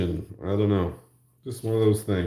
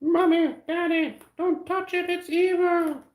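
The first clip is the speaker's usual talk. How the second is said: put-on very high movie-child voice; Time Bandits child. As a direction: imitate a child warning the parents. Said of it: "Everybody remember Time Bandits?".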